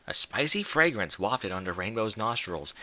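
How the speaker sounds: plain reading voice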